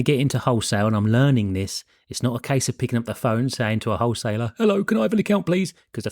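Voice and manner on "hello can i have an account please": naive caller voice. Retold or imitated. Mock naive call to wholesaler.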